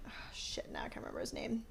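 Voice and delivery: near-silent, frustrated memory lapse; whispered aside. Real, not acted. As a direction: whisper it to yourself while searching. Muttering to self while trying to recall.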